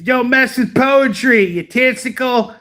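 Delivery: mock tough-guy taunt; mock coach yell. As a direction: bark it like a cocky coach. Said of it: "He's like".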